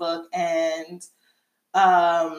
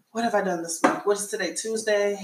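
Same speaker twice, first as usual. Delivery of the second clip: ordinary talk, nothing marked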